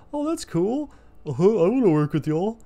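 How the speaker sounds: high-pitched mock voice of an eager buddy; eager friend imitation. Friend asking to work with them, high mock eagerness. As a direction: put on a high, dopey-keen friend voice.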